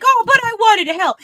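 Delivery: high, pleading whine; whiny sidekick. Retold or imitated. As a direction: beg like a scolded kid. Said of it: "And then he's like".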